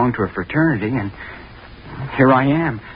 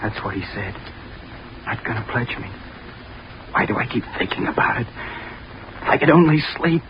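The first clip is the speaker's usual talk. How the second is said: tormented whisper; 5 semitones lower, 7 dB quieter than usual. Acted. Sleepless, tormented whisper to himself.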